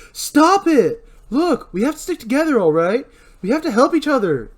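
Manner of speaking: high, loud desperate urging; pleading shout. Acted. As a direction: shout them apart, then plead. Julie breaks up fight.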